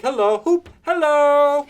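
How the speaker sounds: very high, very loud "whoop, hello!"; delighted shout. A narrator's reading. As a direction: holler after a running figure. Scrooge calls after Friday.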